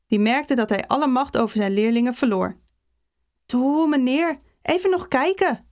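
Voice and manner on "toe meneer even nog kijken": high-pitched begging schoolboys; pleading. A narrator's reading. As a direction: coax the teacher in a high, imploring voice. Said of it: "smeekten de jongens". (hoog, smekend gezeur)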